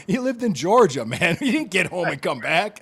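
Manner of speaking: cracking up mid-sentence; laughing. Real, not acted. Laughing at the punchline.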